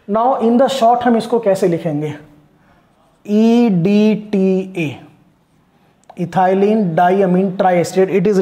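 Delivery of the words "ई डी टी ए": letter by letter, drawn out; slow spelling out. Shown as a demonstration. Teacher dictating the short form to students, slow spelling out. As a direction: give each letter its own slow beat.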